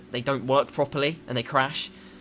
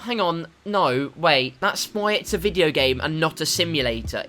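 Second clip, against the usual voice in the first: ordinary talk, nothing marked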